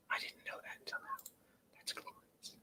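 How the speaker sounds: hushed, delighted whisper about a thumbs down; gleeful whisper. Real, not acted. Streamer whispering to chat, thrilled by a dislike, gleeful whisper. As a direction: whisper it like a happy secret.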